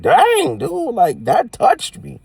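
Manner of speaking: overwhelmed and touched, voice lifting; real emotion breaking through; moved outburst. Real, not acted. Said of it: "I coulda cried".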